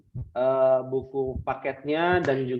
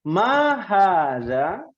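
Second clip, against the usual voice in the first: slow drill question; 3 semitones higher, 3 dB louder, much slower than usual. Shown as a demonstration. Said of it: "Kita mulai".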